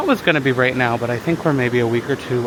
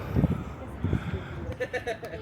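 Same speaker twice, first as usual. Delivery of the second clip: breathy laugh; 9 semitones higher, 9 dB quieter than usual. Real, not acted. Laughs after the climb.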